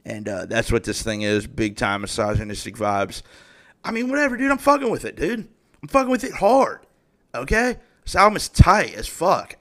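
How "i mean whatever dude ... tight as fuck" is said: hyped. Real, not acted. Hyped about the album.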